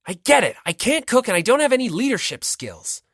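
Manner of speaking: flaring up. Acted. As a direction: snap back, stung and heated. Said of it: "As Hinata flared up, voice becoming more rough".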